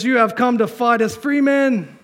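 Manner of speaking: loud, high battlefield rallying cry; hammed-up movie war-speech shout; William Wallace. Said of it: "the great theologian William Wallace".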